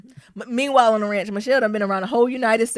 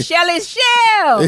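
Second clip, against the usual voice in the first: high, loud, slow drawn-out call; excited greeting; 10 semitones higher, 7 dB louder, much slower than usual. Retold or imitated. Calling out to the First Lady, stretched out and excited. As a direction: stretch the name out like greeting an old friend. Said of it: "I'd be like".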